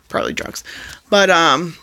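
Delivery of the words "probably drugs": whispered aside. Real, not acted. Repeating the drugs joke to the viewers, now as a whispered aside. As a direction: whisper the words Probably drugs, much softer, as a throwaway.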